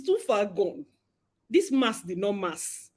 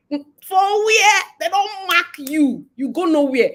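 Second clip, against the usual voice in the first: higher, louder scoff of disbelief; scoffing outburst; 7 semitones higher, 6 dB louder, slower than usual. Real, not acted. Scoffing at the husband's excuse, higher and louder. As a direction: scoff loudly at the very idea.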